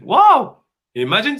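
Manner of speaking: high, loud burst of wonder; amazed wow. Acted. Speaker marvelling to his guest, high loud amazed wow. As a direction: let the wow leap up in awe. Exclaiming at the dying man's insight.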